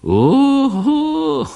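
high, loud astonished "uhu"; delighted exclamation. Acted. Doctor amazed by the poem, loud high exclamation.

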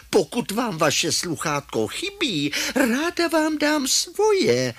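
high, drawn-out grandma, mock-innocent; grandma's voice. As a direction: answer slowly in a high grandma voice. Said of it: "Ale babička řekne". (vysoký, rozvláčný hlas babičky)

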